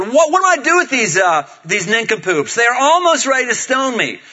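high, loud exasperated plea; Moses crying out. Acted. Moses cries out to the Lord, exasperated plea. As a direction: wail it in mock desperation. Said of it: "then Moses cried out to the Lord".

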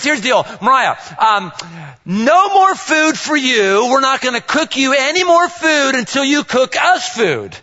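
hammed-up father scolding daughter; mock stern dad. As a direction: lay down the law with playful sarcasm.

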